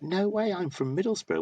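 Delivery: high, excited squeal of disbelief; astonished. Retold or imitated. Stranger in a bar learning they're neighbours, squealing high. Guy amazed at shared hometown.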